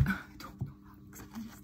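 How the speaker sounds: breathy whisper after a laugh, a bit quick. Real, not acted. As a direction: whisper it to yourself, amused and baffled. Baffled aside while reading cards.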